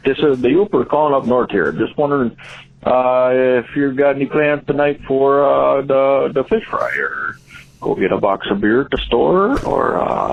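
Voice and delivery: folksy Upper Midwest Yooper caller; small-town Yooper accent, rambling invitation; Upper Wisconsin accent. Acted. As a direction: put on a broad northern Wisconsin accent. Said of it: "trying to do an Upper Wisconsin".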